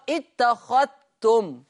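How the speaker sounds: اتخذتم: الذال مدغمة في التاء، اتختم، ببطء; أدغم الذال في التاء; إدغام الذال في التاء. Shown as a demonstration. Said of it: "بيضغم الذال الساكنة في التاء". (اتخذتم بالإدغام)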